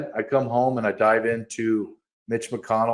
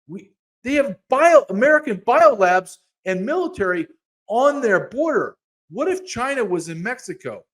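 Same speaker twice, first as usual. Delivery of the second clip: much higher and louder, riled up; heated outburst; 10 semitones higher, 5 dB louder than usual. Real, not acted. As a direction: rail against it. Heated rant on biolabs.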